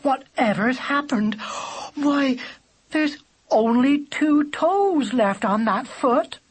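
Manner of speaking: put-on high, slow, fussing old bird; crying out. A narrator's reading. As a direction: cry out in a high, alarmed bird voice. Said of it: "she cried".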